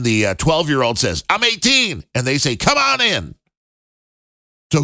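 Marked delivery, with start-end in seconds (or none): 1.3-2.0 high, innocent little fib; mock kid voice. Twelve-year-old lying about age. 2.5-3.3 high, breezy come-in; mock welcoming voice. Company waving kid in.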